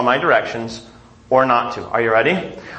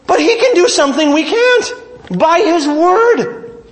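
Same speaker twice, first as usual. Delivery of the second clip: rising preaching; 11 semitones higher than usual. Real, not acted. Preaching at full force.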